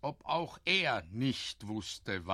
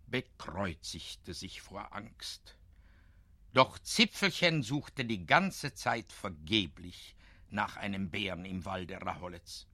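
ordinary delivery, nothing marked

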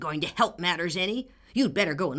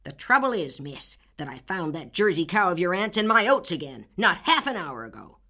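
put-on angry old man; 6 dB louder than usual. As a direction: bellow the grievance. Furious neighbor berates Anne.

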